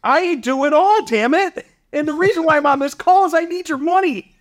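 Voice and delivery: high-pitched, fired-up comeback to the investor; exasperated retort. Retold or imitated. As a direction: voice jumps up, half laughing, as you replay your answer. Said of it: "I said back to him, I go".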